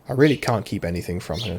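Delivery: normal talking voice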